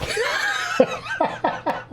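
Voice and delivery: a sudden high burst of laughter; big laugh. Real, not acted. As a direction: crack up at the absurd invitation. Laughs at occult invitation.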